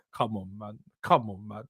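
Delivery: low, soft, exasperated plea; weary disbelief. Real, not acted. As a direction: drop right down low and quiet in disbelief. Exasperated at club's outdated facilities.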